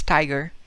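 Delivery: tiger with an aspirated English t; breathy t at the start of tiger. Shown as a demonstration. Teacher giving English tiger before Spanish. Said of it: "In English".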